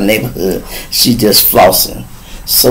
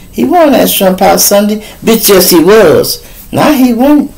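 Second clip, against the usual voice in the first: mock quarrel; 8 semitones higher than usual. Acted quarrel between women.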